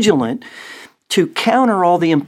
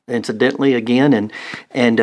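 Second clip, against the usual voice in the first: ordinary talk, nothing marked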